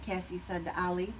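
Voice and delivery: usual speaking voice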